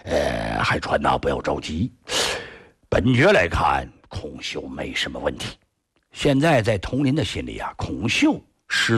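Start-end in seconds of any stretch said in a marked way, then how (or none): 0.0-5.6 低沉稳重的王爷口吻; 慢条斯理地宽慰下属; 王爷安慰. A narrator's reading. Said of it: "安慰他". (雍亲王低声沉稳地安慰海川)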